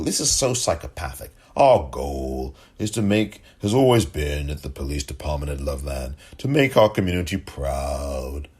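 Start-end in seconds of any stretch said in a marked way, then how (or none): 1.5-8.5 mocking. Mocking repeat of statement.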